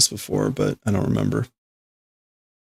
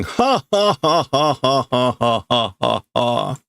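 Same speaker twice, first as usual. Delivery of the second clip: imitating Tetsuo's laugh; 2 semitones higher, slower than usual. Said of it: "We just get Tetsuo laughing".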